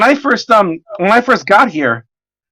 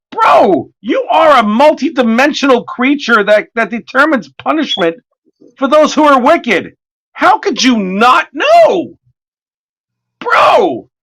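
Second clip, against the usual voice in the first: higher, exasperated calling out to the devil; incredulous rant; 6 semitones higher than usual. Retold or imitated. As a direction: chew him out in disbelief. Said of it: "And I'm like".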